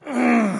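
short closed-mouth hum of frustration; frustrated grunt. Shown as a demonstration. Preacher showing the author's frustration, a closed-mouth mm. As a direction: let out a fed-up mm. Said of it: "lets out this".

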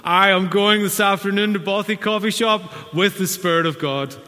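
loud, high pompous announcement; a preacher mocking showy piety with a grand declaration; mock super spiritual. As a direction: ham up a self-important believer. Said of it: "Please don't be super spiritual".

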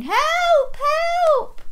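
very high squeaky help cries; piping mock distress; pretend veggie puppet. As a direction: squeal like a helpless puppet vegetable. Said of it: "he's saying".

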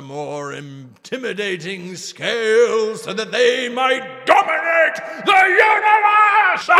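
high, loud, slow half-sung villain boast; mad villain. Acted. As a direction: declaim world domination like a mad scientist. Voicing mad dragon plot.

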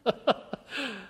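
a real amused laugh, breaking off the reading; short laugh. Real, not acted. Reader laughing at the nativity girl, real laugh. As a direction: chuckle at the runaway Mary.

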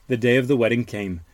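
usual speaking voice

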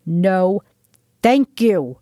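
a contained narrated roar; mild roar. A narrator's reading. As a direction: push the words out firmly. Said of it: "pushed him into the opposing wall of the corridor and roared".

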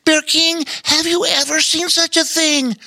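high critter voice bubbling over; bubbly otter. A narrator's reading. Otter asking Bear King about the feat, bubbly high voice. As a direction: gush it out to the bear. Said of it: "he bubbled out".

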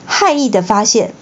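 ordinary delivery

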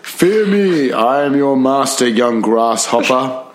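high booming then slow villain taunt; hammy evil master threatening; sneering panto villain. Acted. Villain voice continues.

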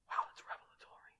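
breathy mock-awed whisper, deadpan; sarcastic whisper. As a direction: whisper it like a fake revelation. Mock awe at simple advice.

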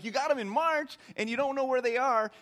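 high, loud disbelief at the phone call; mock-outraged, you lost them?; incredulous outburst. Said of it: "I'm like".

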